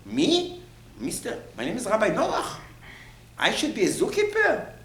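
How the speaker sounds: high-pitched mock outrage; indignant disbelief. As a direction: act insulted at the lowly job, pitch shooting up. Acting an offended Noach refusing.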